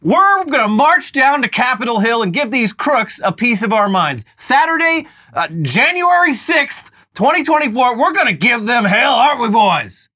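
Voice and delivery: high-pitched mock rally cry; fired up. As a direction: rouse the crowd for a march. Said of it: "I got a little fired up there".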